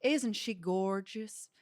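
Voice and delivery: slow, stretched Southern drawl; Southern woman showing off, slowly; Southern accent. Retold or imitated. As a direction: imitate a proud Southern lady, drawing it out. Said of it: "she had a Southern accent too".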